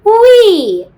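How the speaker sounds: high, loud gleeful wee; playful wee. Shown as a demonstration. Teacher guiding kids' pencil dive, high gleeful wee. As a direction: swoop up like a slide.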